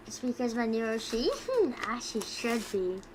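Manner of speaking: ordinary talk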